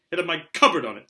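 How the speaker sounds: put-on boy defying his uncle loudly; loud protest. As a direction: push back hard. Harry arguing back.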